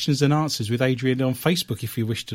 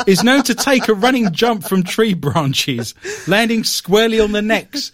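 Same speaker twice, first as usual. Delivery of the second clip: raised, swooping British newsreel voice; old English British accent; 5 semitones higher, 3 dB louder than usual. Acted. Host describes squirrel attack, mock old British newsreel accent. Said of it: "I'm gonna do this in an old English British accent".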